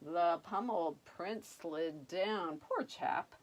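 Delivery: plain reading voice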